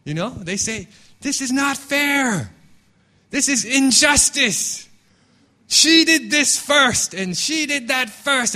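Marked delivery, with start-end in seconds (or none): 1.2-8.5 put-on high, drawn-out childish protest of unfairness; indignant kids. Kids in church protesting unfair treatment, high indignant whine. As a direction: stretch the complaint out, high and aggrieved. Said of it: "we feel this incredible sense of injustice".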